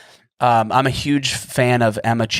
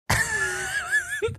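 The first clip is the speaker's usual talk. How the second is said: real high-pitched squeal of laughter; sudden high giggle at himself; 7 dB quieter than usual. Real, not acted. Amused at catching own impression.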